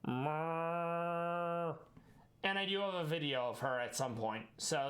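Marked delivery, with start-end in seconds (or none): 0.1-1.8 cat trill. Retold or imitated. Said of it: "She used to go".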